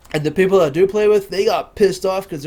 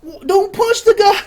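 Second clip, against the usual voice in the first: mock scolding; 11 semitones higher, 6 dB louder than usual. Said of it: "my friends are like".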